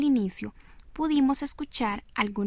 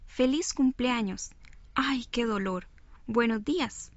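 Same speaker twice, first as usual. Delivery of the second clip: ordinary delivery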